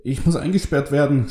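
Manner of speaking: high, halting stammer; hesitant start, rising into a high-pitched plea. A narrator's reading. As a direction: stutter the first word, then blurt it out. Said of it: "stotterte Lester".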